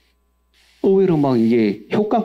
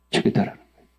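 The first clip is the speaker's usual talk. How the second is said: 1 whispered repeat; 3 dB quieter, faster than usual. Acted.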